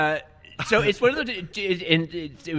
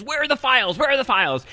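higher, louder, insistent; demanding old boss; 11 semitones higher, 4 dB louder than usual. Retold or imitated. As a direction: bark it like a cranky CEO. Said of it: "he says".

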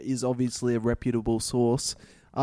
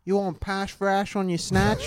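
high bogan Australian; bogan chick accent; 6 semitones higher than usual. Acted. Co-host joining the bogan bit, high Australian. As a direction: proposition like an Australian bogan woman. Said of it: "Bogan chicks have the hottest accent".

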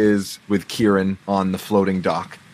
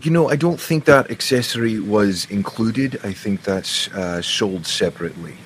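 a wry, satisfied in-character reply; a touch of a put-on gentleman voice; lightly voiced character; 2 semitones higher than usual. Character banter in voice.

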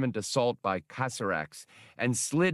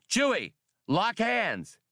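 calling out; 11 semitones higher than usual. A narrator's reading. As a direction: shout it high to his partner.

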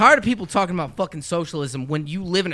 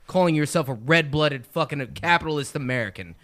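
ordinary talk, nothing marked

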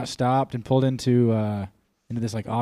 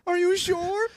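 mock tearful plea; slower than usual. Acted suspect pleading.

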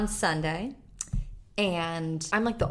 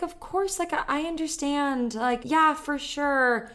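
much higher, sweetly compliant yes-woman; doormat voice; 7 semitones higher, slower than usual. Retold or imitated. Retelling meekly agreeing as the boss lets her go, high and sugary. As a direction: chirp the agreement like a pushover. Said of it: "I turned into the world's prettiest doormat".

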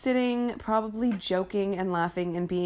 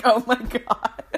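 retold crying; 4 semitones higher, 5 dB louder than usual. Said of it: "I immediately start crying".

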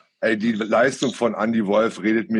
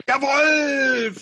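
excited shout; 14 semitones higher than usual. Real, not acted. Shouting the new hashtag.

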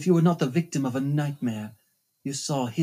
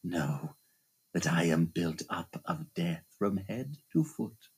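a hushed low menacing growl; low menacing phantom; 11 semitones lower, 6 dB quieter than usual. Acted. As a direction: press each word in a dark hush. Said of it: "he shouted".

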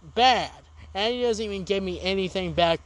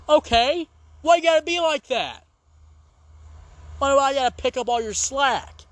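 loud, higher, fed-up complaint; exasperated rant; 6 semitones higher, 3 dB louder than usual. Real, not acted. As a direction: vent at the freeloader. Ranting at lazy guildmate.

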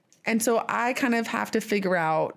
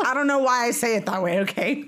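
quick, higher, laughing self-defense; mock defensive; 5 semitones higher, faster than usual. Retelling a reply to teasing, mock defensive. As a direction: protest it fast through a laugh.

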